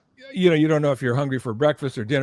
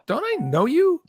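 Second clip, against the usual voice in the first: 1 imitating Keith, much higher; 13 semitones higher than usual. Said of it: "the first thing he said to me is".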